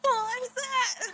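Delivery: high, slow startled question. Acted. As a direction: jump at a sound. Startled by noise.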